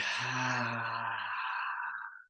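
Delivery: long, open exhaled sigh. Real, not acted. Leader resetting before the next exercise step, long sigh. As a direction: let the whole breath go slowly. Releasing breath between exercise steps.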